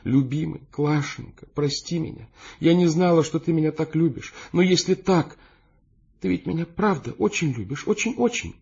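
high-pitched, emotional woman; tearful murmur. A narrator's reading. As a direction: plead lovingly through swallowed tears. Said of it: "бормотала она, глотая слёзы". (сквозь слёзы, умоляюще)

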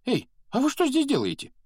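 very high, loud startled question; high, loud put-on voice of sudden surprise; surprised ghost. A narrator's reading. Ghost suddenly notices the first-years.

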